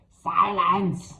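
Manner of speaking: sharp shouted command; mild mock scream; hag's scream. A narrator's reading. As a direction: bark it like a furious old hag. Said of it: "she screamed".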